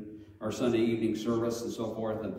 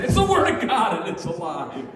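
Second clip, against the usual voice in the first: showman's reveal; 6 semitones higher, 8 dB louder than usual. Retold or imitated. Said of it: "and say".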